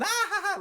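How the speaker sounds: very high mock screaming laugh; high cackling scream; imitated laughing scream. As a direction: shriek with pretend crazed laughter. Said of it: "She's screaming".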